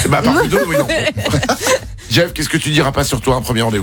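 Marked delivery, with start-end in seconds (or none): 0.9-2.0 high giggling laugh; real laughter. Real, not acted. Host joking with co-host about couples, real high laughter. Laughing at his own joke.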